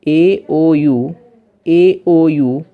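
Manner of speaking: ए ओ यू stretched very slowly, letter by letter; vowels drawn out. Shown as a demonstration. Teacher slowly spelling vowels to a class. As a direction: lay out ए ओ यू slowly so students catch each vowel. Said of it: "teacher spells out the vowels".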